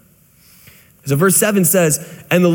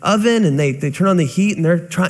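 ordinary talk, nothing marked

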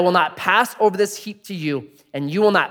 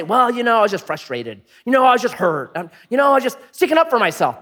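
higher, whiny excuse-making; mock excuses; 7 semitones higher, 4 dB louder than usual. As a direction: play someone dodging blame. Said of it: "Don't say".

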